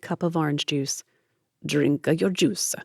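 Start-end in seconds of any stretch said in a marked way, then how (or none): 1.6-2.7 slow, hammed-up Italian accent; overdone Italian mama accent, slowly. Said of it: "hyperbolic imitation of an Italian accent".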